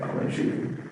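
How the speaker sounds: soft, toneless near-whisper; quiet monotone. Retold or imitated. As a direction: drain every feeling out, hushed. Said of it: "a monotone voice, like".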